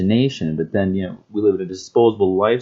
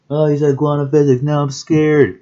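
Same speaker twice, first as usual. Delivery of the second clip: higher, louder teasing voice of a spooked viewer; mock scared listener; 8 semitones higher, 5 dB louder than usual. Mocking a viewer scared by quantum physics, lightly raised teasing voice. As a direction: play a listener pretending to be frightened, higher and louder.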